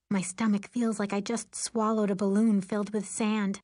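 ordinary delivery

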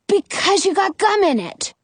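high, eager exclamation; exclaiming. A narrator's reading. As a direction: blurt it out as a bright idea. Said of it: "Jonah exclaims".

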